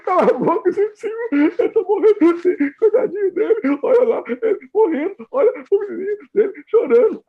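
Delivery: loud, high, hammed-up distress; emotional-mind voice. Emotional mind snaps back at reason over a dying boy, hammed-up high wail. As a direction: wail it in a comically overwrought high voice. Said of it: "sua mente emocional faz assim".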